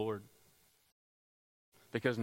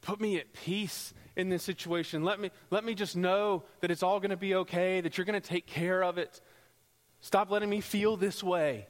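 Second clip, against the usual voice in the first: high, pleading prayer; calling out pleadingly; 7 semitones higher, 4 dB louder than usual. Acted. Listener calling out to the Lord for peace, urgent plea. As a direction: beg God, building in urgency. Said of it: "calling out, and you're calling out".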